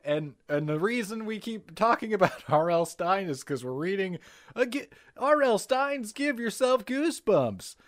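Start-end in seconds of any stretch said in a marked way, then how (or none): 4.5-7.8 booming TV promo announcer. Pretend. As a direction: sell it like a fighting game announcer. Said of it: "you have the best, like, announcer voice".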